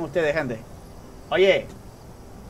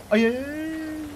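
higher, drawn-out anxious ay; nervous wail; 8 semitones higher, 3 dB quieter, much slower than usual. Real, not acted. Gamer nearly caught, nervous high wail. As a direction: whine with nerves. Neighbor nearly catches him.

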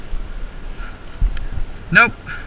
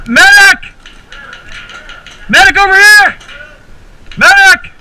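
loud, high call for a medic; man down shout; 9 semitones higher, 6 dB louder, slower than usual. Real, not acted. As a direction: yell for the medic across the field. Calling for medic in game.

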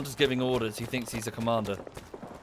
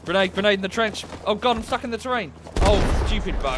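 high, loud, frantic shouting; yelling in panic, stuck in place; panicked yell; 8 semitones higher, 6 dB louder than usual. Real, not acted. Grenade then stuck in terrain.

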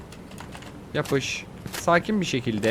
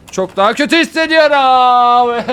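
loud, overblown cry of misery; exaggerated wail; 8 semitones higher, 8 dB louder than usual. Real, not acted. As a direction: wail it out in frustration. Wails about being sick and failing.

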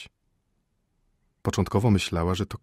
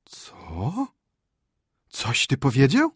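scandalised, swooping question; shocked wife; 6 semitones higher, 5 dB louder, much slower than usual. Acted. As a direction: demand a repeat in disbelief.

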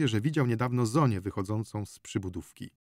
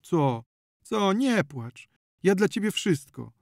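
effusive comforting; 5 semitones higher, 5 dB louder than usual. A narrator's reading. Zonia tearfully soothes crying Marysia.

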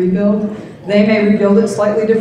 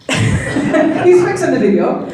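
high, slow, laughing jab; teasing laugh; 9 semitones higher, 4 dB louder, slower than usual. Real, not acted. Speaker jokes that Mike can't be bothered, laughing. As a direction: laugh while teasing the absent colleague.

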